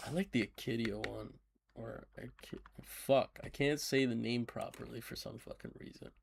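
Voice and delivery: ordinary talk, nothing marked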